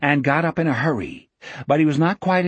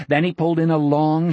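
plain reading voice

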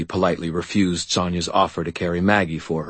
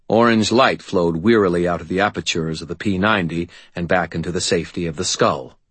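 usual speaking voice

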